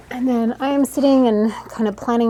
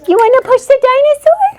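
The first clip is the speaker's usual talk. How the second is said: much higher, louder singsong question; 13 dB louder than usual. Real, not acted. Photographer enticing toddler with dinosaur, excited.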